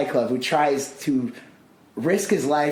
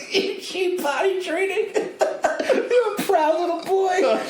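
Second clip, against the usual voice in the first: put-on very high, slow, laughing baby talk; 14 semitones higher, slower than usual. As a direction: coo at a toddler. Mock praising a toddler.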